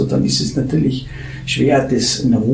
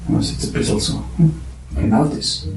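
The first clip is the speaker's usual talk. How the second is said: ordinary delivery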